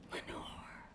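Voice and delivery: soft unvoiced whisper of a name. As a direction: breathe the name into the dark. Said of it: "the whispered word".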